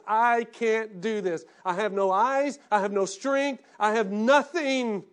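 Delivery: slow, loud, pleading words of Samson; loud anguished confession. Retold or imitated. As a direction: cry it out from the bottom, drawing out each line. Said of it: "Samson realized".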